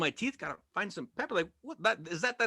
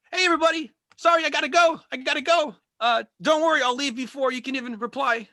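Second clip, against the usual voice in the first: high, loud mock voice of someone leaving mid-show; rude chat user; 10 semitones higher, 5 dB louder than usual. Comic mocks Zoom chatter leaving show, high loud voice. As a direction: chirp it like a clueless chat poster. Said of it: "Or, uh".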